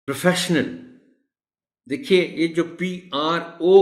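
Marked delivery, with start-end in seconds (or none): 0.1-0.7 प्रोफेशनल begun with a short "pruh"; correct pronunciation. Shown as a demonstration. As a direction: model प्रोफेशनल as "pruh-FESH-nal". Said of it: "प्र की sound है". (प्रोफेशनल کا تلفظ "پرَ" سے)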